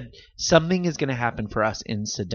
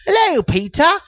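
squeaky high mock hello; high silly greeting; 6 dB louder than usual. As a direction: chirp it up high like a cartoon. Greeting fan in funny voice.

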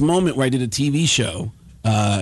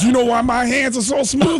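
much higher put-on comic's voice; Tracy Morgan; 10 semitones higher than usual. Star comic repeats his random question, Tracy Morgan impression. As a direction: imitate an eccentric comic insisting on his question. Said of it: "And he goes".

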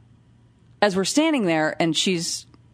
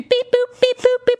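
loud, very high beeps and boops; imitated monitor beeps; 6 dB louder than usual. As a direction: mimic the monitor relentlessly beeping.

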